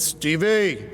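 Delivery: light Brooklyn grandfather joke; a hint of a Brooklyn accent. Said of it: "He used to joke in his Brooklyn accent".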